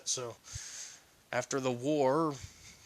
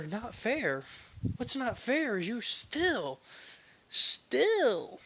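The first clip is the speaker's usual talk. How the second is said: indignant scolding; 9 semitones higher, slower than usual. Acted. Momo scolding Izuku, high and indignant. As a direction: build up hot and indignant.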